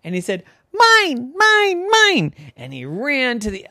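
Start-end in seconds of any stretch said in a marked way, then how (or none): 0.7-2.3 high, loud cries of mine; triumphant shouting. Acted. Boy grabbing his ball back from seagulls, loud triumphant. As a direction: yell it like a possessive little gull.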